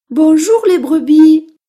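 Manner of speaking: high, loud, drawn-out greeting as a mouse; cheerful little mouse. Acted. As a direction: call out slowly to the flock as a mouse. Mouse hails the sheep. (petite souris qui salue fort)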